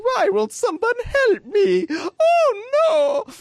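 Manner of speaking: very high, slow, loud wail; wailing Mario. Retold or imitated. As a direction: cry out like a cartoon plumber in distress. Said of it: "And he's like".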